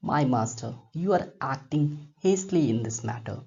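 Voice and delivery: slow read-aloud narration; measured classroom reading; story reading. A narrator's reading. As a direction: read each phrase slowly for students. Reads story passage aloud.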